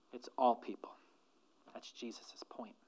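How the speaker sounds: hushed, breathy aside to the congregation; soft whisper driving the point home. Real, not acted. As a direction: let the point land in a whisper. Quiet aside after key point.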